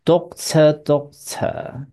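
slow "doctor" twice, final R left silent; "doctor" with rounded O, ending on a plain schwa; British non-rhotic doctor. Shown as a demonstration. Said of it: "英国人念这个字的O R是不卷舌". (慢慢念英式 doctor，不卷舌)

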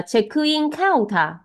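チェックインカウンター rising high toward カ, then falling; corrected accent. Shown as a demonstration. Teacher corrects student's accent, チェックインカウンター high then falling at カウンター. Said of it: "重音有点跑掉了".

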